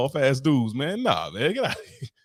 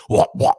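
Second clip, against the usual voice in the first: loud fake gag noises; mock gagging; 7 dB louder than usual. Mocking loud noises in bed, fake gagging. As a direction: gag loudly for comic effect. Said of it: "throw up noises".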